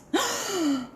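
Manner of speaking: high shocked gasp; dramatic gasp. Acted. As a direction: gasp in horror. Zebra finds stripes gone.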